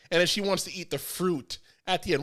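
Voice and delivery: ordinary talk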